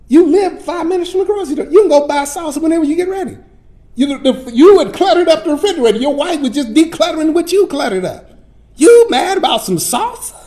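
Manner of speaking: the Lord scolds the preacher over salsa, exasperated; the Lord scolding. Retold or imitated. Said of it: "Lord said".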